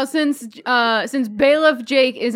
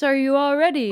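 usual speaking voice

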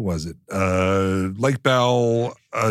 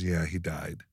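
quiet sad news; 6 semitones lower, 11 dB quieter, slower than usual. Retold or imitated. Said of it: "She goes".

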